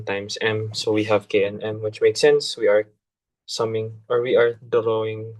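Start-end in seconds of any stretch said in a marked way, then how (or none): none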